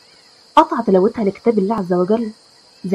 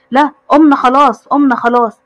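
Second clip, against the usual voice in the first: mock-scared, hasty surrender, louder; afraid; 4 semitones higher, 7 dB louder than usual. A narrator's reading. Brothers getting up, scared of their sister, mildly panicky. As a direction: protest loudly as if in trouble. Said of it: "وهما قايمين وكده بخوف منها".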